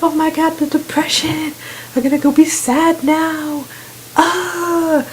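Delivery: high, whiny mock despair; mock depressed viewer. Imagined viewer overcome by the game's sadness, high mock despair. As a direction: put on a hammed-up high-pitched sad voice. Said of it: "so you don't be all like".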